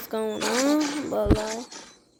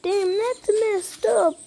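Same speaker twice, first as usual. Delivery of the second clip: high, slow mock reaction from a viewer; high-pitched, stretched-out imagined reaction; imagined viewer's thought; 9 semitones higher, slower than usual. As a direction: voice an onlooker's thought, high and drawn out. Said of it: "you might be thinking to yourself".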